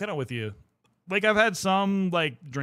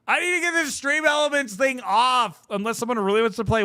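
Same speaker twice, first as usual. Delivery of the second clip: much higher and louder, an exasperated shout; sudden loud outburst; 9 semitones higher, 6 dB louder than usual. Real, not acted. Streamer to herself about the spamming overlay, exasperated shout. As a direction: blurt it out loud in annoyance.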